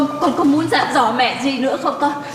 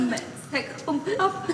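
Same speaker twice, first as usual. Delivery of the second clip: much softer, swallowing back tears; choked whisper; 10 dB quieter than usual. Acted. Mother promises her son she won't cry, in a choked near-whisper. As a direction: promise through a lump in the throat.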